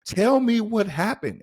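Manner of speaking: hammed-up pleading order; urgent demand. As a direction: demand it like you're about to shake them. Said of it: "You wanna grab somebody and shake 'em".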